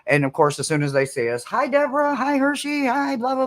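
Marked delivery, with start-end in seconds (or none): 1.4-3.5 much higher, sing-song hello; cheery shopkeeper greeting. Retold or imitated. Shopkeeper greets regular customers in a high sing-song voice. Shop owners greeting them.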